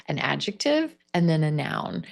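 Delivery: ordinary talk, nothing marked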